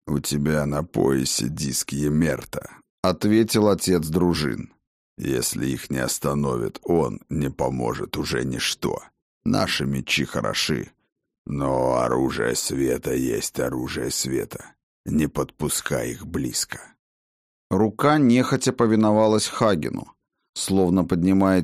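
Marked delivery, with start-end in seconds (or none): 0.1-2.7 low, grave warrior voice; stern old warlord. Acted. Voicing the war father. 5.2-16.8 low, grave warrior voice; stern old warlord. Acted. Voicing the war father.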